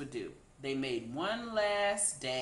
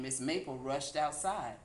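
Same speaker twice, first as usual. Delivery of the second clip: ordinary delivery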